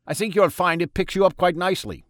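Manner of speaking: put-on high, loud German-accented doctor; a jolly German doctor offering cocaine; Freud in German accent. A narrator's reading. Freud offers God cocaine, German-accented and high.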